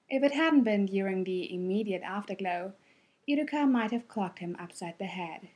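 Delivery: usual speaking voice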